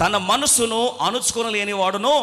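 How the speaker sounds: slow, loud verse echo; preacher repeating the verse to the congregation, emphatic; emphatic scripture repeat. Acted. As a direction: drive the verse home slowly. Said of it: "చదవండి".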